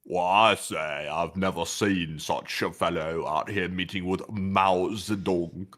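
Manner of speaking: slow, put-on onlooker's drawl; put-on character voice. Co-host plays along at seeing Kissinger, slow put-on voice. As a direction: play an astonished onlooker, taking it slowly.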